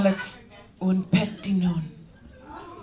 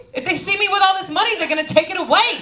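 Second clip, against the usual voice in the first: higher, louder and fast; mock panic; 13 semitones higher, 6 dB louder, faster than usual. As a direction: blurt it out in a hammed-up panic. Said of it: "I was like".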